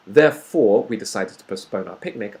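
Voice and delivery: loud "Therefore," run straight into "we decided to postpone our picnic" with no break; no pause. Shown as a demonstration. Teacher shows students a sentence read with no pause after "Therefore,". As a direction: go from "Therefore," into the rest without stopping. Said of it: "I wouldn't pause after that".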